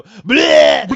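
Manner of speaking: loud retching bleh; gross spit-out noise. Pretend. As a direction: spew it out, gross. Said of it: "I'm surprised she didn't go".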